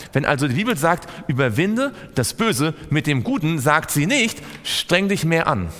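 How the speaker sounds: insistent preacher; preaching. Acted. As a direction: drive the point home to the congregation. Preaches with rising intensity.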